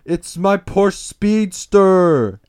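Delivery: loud, high, hugely drawn-out wail; mock freak-out. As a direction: howl it like a parody of heartbreak. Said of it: "he's freaking out a bit more".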